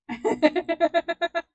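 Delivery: loud, high burst of laughs; burst of laughter. Real, not acted. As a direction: laugh out loud in a rapid run. Laughing at the remark.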